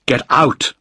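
sharp cry of warning; urgent shout. A narrator's reading. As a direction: order them out urgently. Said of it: "I shouted".

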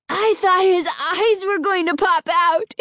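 high, gleeful outburst; gleeful exclamation. A narrator's reading. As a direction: exclaim it with delight. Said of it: "Alan exclaimed gleefully".

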